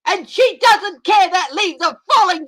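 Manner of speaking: hammed-up stern TV judge; Jeanine Pirro impression. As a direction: hand down a verdict like a TV judge. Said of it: "I can do my Jeanine all day".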